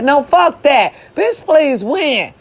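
ordinary delivery

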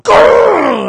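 loud, high pretend growl as he pounces; playful attack growl; mock growl attack. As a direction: snarl like you're jumping him. Sneaking up to choke him.

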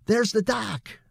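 put-on a young man pointing out the dock; Billy voiced. A narrator's reading.

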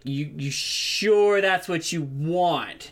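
a guard who can't believe the request; low slow guard asking; doubtful guard. Acted. Guard NPC questions the party.

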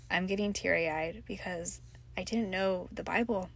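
ordinary talk

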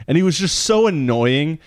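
usual speaking voice

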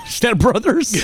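laughing. Real, not acted. Laughing reference.

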